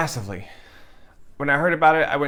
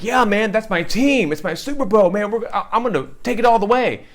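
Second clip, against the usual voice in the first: higher and louder, pumped about his team; excited football fan; 6 semitones higher, 4 dB louder than usual. Retold or imitated. Straight friend hyped about the Dallas Cowboys, higher and louder. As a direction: cheer for your team like it's the Super Bowl. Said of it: "He's like".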